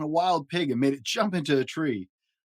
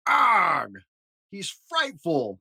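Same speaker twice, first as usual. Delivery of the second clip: loud growly Arg, then slow and high; proud monster parents cooing over an ugly baby; happy monster parents; 4 semitones higher, 4 dB louder, much slower than usual. A narrator's reading. Dad reading to his child, voicing proud monster parents. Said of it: "sighed Mister and Missus Monster happily".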